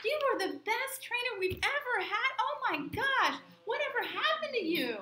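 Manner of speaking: high, loud gushing praise; a clerk raves about her former trainer, high and loud; raving. Retold or imitated. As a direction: gush like a thrilled old colleague. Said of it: "she starts raving".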